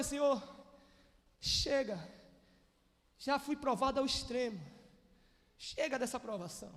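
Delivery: quiet, exhausted plea to God; weary prayer. Retold or imitated. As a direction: pray it, worn out, on your knees.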